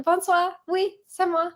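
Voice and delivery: put-on playful French accent for the Uber driver; French accent, greeting the driver lightly. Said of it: "I'm like".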